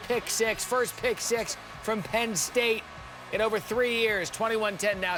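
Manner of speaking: excited. Real, not acted. Sideline reporter excited update.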